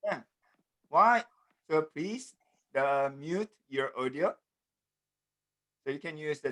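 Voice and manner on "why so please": normal talking voice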